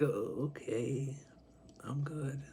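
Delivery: very soft, unhurried shrug of a refusal; drawn-out okay, then a hushed decline; satisfied, indifferent. Acted. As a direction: wave it away quietly. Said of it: "who is so satisfied".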